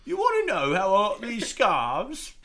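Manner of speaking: mock-English villain voice; Joker, English accent. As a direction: drag it out in a comic English accent. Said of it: "the Joker had an English accent".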